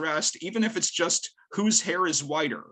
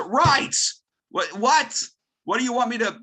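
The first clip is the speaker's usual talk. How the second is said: high, louder, incredulous reaction; baffled protest; 9 semitones higher, 5 dB louder than usual. Retold or imitated. Teacher reacting to an impossible assigned lesson, baffled. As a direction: act out being thrown by an impossible demand.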